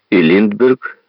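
plain reading voice

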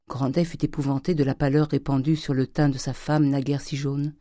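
ordinary delivery, nothing marked

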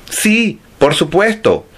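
high, sweet innocent voice; innocent lie. Acted. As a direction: lie brightly as if nothing happened. Pipina lies to the monster.

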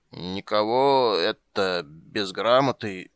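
put-on slow, drunken slur. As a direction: drag it out like a tipsy guard. Tipsy soldier after belching.

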